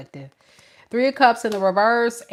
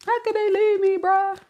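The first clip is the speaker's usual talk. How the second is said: much higher mock whimper; whiny child; 10 semitones higher than usual. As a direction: whine it like an abandoned kid. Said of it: "whining and complaining like a child".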